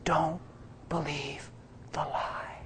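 soft breathy whisper, fading to nothing; gentle whisper. Retold or imitated. The inner voice whispering gently to the preacher, soft whisper. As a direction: whisper it tenderly like a still small voice. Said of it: "it whispered so gentle".

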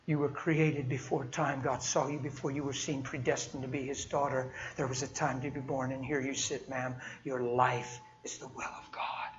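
a quiet, loving prophecy fading into a whisper; tender blessing. Retold or imitated. Tender prophetic words to stranger.

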